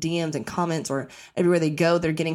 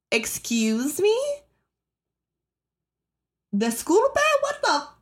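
high, slow affronted "excuse me"; sassy switch into Spanish, higher; mock indignant; 12 semitones higher, slower than usual. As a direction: act offended at a stranger's nerve. Imagined reply to rude stranger.

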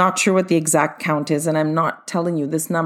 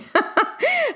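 high laugh. Real, not acted. Laughs at own rhyme.

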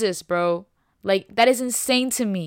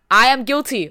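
louder, owning it openly; loud confession; 3 semitones higher, 8 dB louder than usual. Real, not acted. Preacher confesses loudly.

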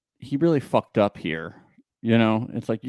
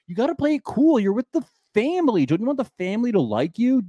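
higher, lively scolding; exasperated; 8 semitones higher, faster than usual. Real, not acted. Telling the guy to play it cool, exasperated. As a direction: scold him like a coach.

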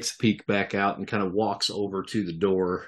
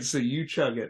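ordinary delivery

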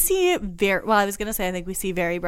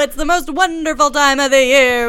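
mock sportscaster bravado; sports announcer; 4 semitones higher, 10 dB louder than usual. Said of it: "I don't know how people talk about sports".

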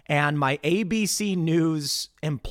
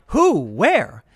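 mock puzzlement; 5 dB louder than usual. Acting out confused looking around.

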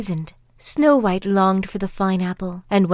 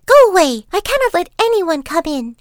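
put-on high, loud girlish refusal; young girl refusing; 10 semitones higher, 7 dB louder than usual. A narrator's reading. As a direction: shoo her off like a frightened girl.

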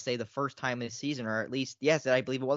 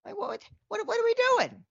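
incredulous. Real, not acted. Mock outrage at flopping.

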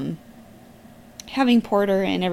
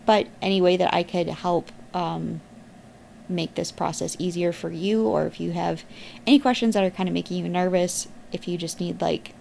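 usual speaking voice

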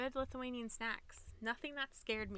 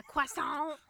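loud, hammed-up French croissant; mock French accent; 5 semitones higher, 7 dB louder than usual. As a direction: play up a French pronunciation, pitched higher. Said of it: "Why you make everything French?".

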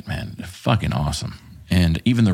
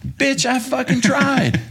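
mock exasperated retort; slower than usual. Mocking retort to colonel.

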